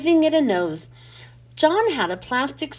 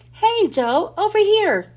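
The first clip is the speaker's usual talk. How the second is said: calling out; 6 semitones higher, 4 dB louder than usual. Acted. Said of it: "called John".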